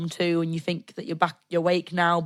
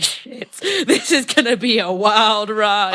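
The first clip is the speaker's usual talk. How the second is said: laughing dread; 3 semitones higher, 6 dB louder, slower than usual. Retold or imitated. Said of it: "I was like".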